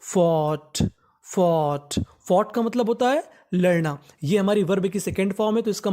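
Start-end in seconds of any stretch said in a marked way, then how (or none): 0.0-2.0 "फॉट" said twice, low and careful; teacher showing how to read "फॉट"; model pronunciation. Shown as a demonstration. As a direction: pronounce "फॉट" slowly for students. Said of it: "इसे हम लोग पढ़ते हैं".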